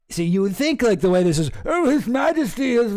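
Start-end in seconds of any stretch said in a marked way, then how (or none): 1.6-2.9 mock royal voice. Imagined royal ending.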